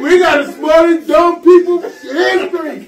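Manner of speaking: high, loud, laughing outburst; laughing shout. Real, not acted. Group cracking up at a joke.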